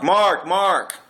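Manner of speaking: high, loud call across a room. Retold or imitated. Coworkers in the stock room calling loudly for Mark. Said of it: "They're calling out for Mark".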